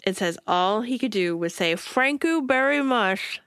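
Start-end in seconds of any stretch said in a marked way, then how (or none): 1.8-3.4 words squeezed through shut teeth, slow; through clenched teeth. Retold or imitated. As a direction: talk with jaw clamped shut. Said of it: "through his clenched teeth".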